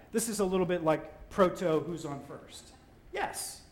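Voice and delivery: ordinary talk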